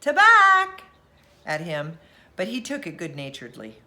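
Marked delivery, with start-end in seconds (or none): 0.0-0.8 loud, high mocking yell; teasing shout. A narrator's reading. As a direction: shout it to tease the boy. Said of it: "tease Harvey by shouting".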